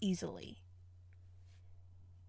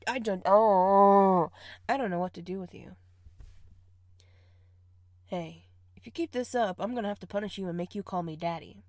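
normal talking voice

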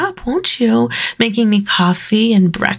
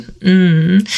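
normal talking voice

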